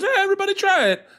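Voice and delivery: very high, hammed-up cook's invitation; chef character voice. Comedian mimics the cook offering tastes, character voice. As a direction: offer it round in a high put-on voice. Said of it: "And he goes".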